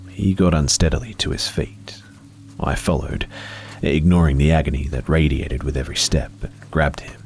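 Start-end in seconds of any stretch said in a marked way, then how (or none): none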